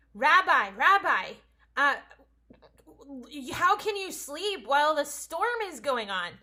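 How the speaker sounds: loud, urgent cry for help; pleading disciples. Retold or imitated. Disciples waking Jesus in the storm, loud pleading. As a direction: beg the teacher to wake up. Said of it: "they went over to Jesus and they say".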